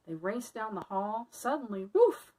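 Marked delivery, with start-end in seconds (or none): 1.8-2.3 loud, high barking woof; fierce dog bark. Acted. Fierce dog barking at the fleeing mice, loud. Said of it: "barked a fierce, angry dog".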